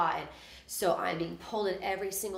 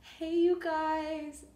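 much higher and slow, sing-song sorry; sheepish apology; 10 semitones higher, much slower than usual. Retold or imitated. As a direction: make up with the band, embarrassed. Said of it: "I was like".

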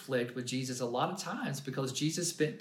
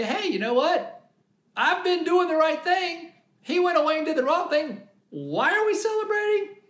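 high, louder, indignant mock protest; upset older brother; 12 semitones higher, 5 dB louder than usual. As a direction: whine it like the wronged faithful son. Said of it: "is he gonna be a little upset to say".

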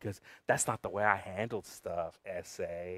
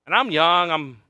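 normal talking voice

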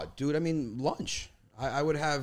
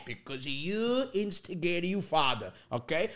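slow, higher-pitched imitation of dad; lecturing dad; 5 semitones higher than usual. Son imitates dad blaming him, slow scolding. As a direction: draw it out like a father justifying himself.

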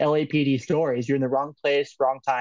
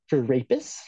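snide cop's comeback, lightly imitated; snarky. Retelling a cop answering kids about their knives, snarky. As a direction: toss it out as a dry, snarky question. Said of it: "like, snarkingly goes".

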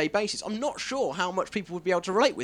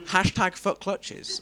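slow tagline; 2 semitones higher, much slower than usual. Acted. Comic tagline.

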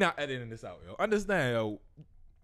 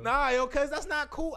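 slow, much higher heated outburst; slow down and let the anger rise high in pitch; heated rant; 4 dB louder than usual. Real, not acted.

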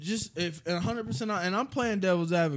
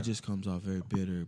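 much lower, softer put-on voice; deep monotone; 9 semitones lower, 4 dB quieter than usual. Telling a friend why he sounds bitter, deep monotone. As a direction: mimic a bitter talker in monotone. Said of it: "you're putting on a deep voice".

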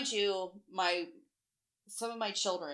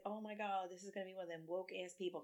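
softly retold aside, much quieter; quiet inner groan; 12 dB quieter than usual. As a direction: think it aloud under your voice.

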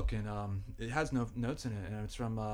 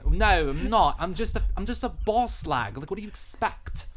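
higher, louder hammed-up British accent; mock British accent; 6 semitones higher, 7 dB louder than usual. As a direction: play a brash British barmaid. Voicing British Alex in recap.

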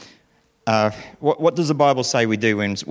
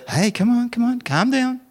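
a hint of a soft soothing mother, pitched up; calm the child down tenderly; gentle calming; 11 semitones higher than usual. Mother Hannah soothes her screaming kids, lightly voiced gently. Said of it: "Hannah goes, goes quietly".

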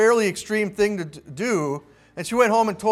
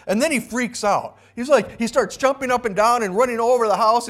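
ordinary talk, nothing marked

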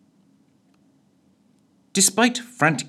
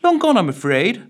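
plain reading voice

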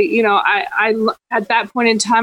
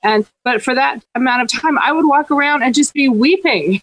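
ordinary delivery

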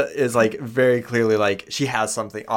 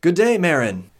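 put-on a greeting pitched higher and louder, like an old healer; old healer greets the farmer Marin, higher and louder; old woman's greeting; 8 semitones higher, 4 dB louder than usual. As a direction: call out like an old village elder. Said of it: "And you hear her go".

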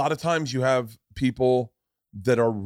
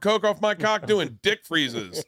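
cocky contestant; 7 semitones higher than usual. Acted. Contestant describing his perfect date to Jane, cocky contestant. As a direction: pitch the date with raised, hammed-up swagger.

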